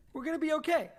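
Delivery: high, pleading reassurance; reassuring. Acted. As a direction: talk yourself into calm, up high.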